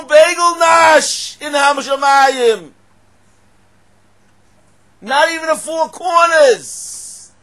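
high, drawn-out shouting; thundering, incredulous rabbi at the top of his voice; preaching at full force. Acted. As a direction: holler each phrase slowly, stretching the last word.

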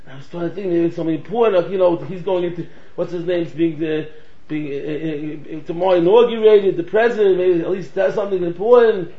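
ordinary delivery

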